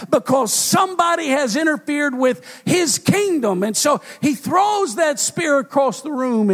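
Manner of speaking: fiery sermon climax; preaching at full force. Acted. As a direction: preach it hard to the congregation.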